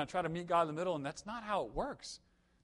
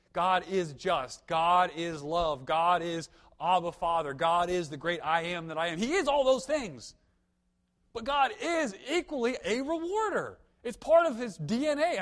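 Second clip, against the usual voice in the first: louder, slow, ringing declarations; full-force preaching; 3 semitones higher, 7 dB louder, slower than usual. Acted. Pastor declaring who God is to the congregation, full-force preaching. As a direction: proclaim each line to the whole room.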